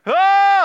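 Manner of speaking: sudden high shouted "Oh!"; imitated loud cry. Preacher mimicking a crowd-shaking cry, loud. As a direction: belt out a megaphone-loud cry. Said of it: "It was so loud to those nearby that it was like".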